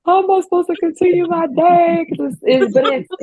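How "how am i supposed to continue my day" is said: high, loud overwhelmed cry; overwhelmed exclaim. Retold or imitated. Host overwhelmed by the guest, high and loud. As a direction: throw it up high in disbelief. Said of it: "I'm like".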